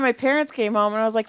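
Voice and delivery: ordinary talk, nothing marked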